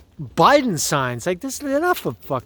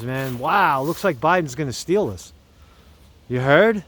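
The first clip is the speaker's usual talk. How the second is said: ordinary talk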